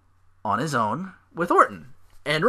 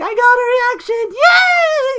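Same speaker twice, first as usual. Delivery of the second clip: shrieking way up high and loud with a laugh; delighted squealing internet troll; gleeful troll; 10 dB louder, slower than usual. Retold or imitated. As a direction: cackle like a troll who finally got a rise. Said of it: "Like, he's pleased as punch".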